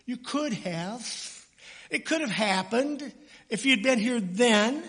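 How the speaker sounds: grieving sister pleading with Jesus; Mary's anguished plea. Retold or imitated. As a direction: beg him with grief and reproach. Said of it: "the reaction of Mary was one of".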